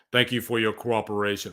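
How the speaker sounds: low, measured cyborg cop voice; robotic movie-cop quote. Acted. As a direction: recite it like a robot officer. Quotes RoboCop's catchphrase.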